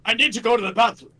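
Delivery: loud, high put-on voice; crazed character quote. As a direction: blurt it out in a silly high voice. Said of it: "And then he's like".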